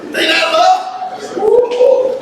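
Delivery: high, exclaimed rhetorical question; jubilant holler. Real, not acted. Preacher celebrating the brothers' teasing as love, high jubilant holler. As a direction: holler it out, pitch well up.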